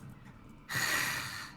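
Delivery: long, voiceless sigh; exasperated sigh. Acted. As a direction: let out a long acted sigh. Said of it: "And she goes".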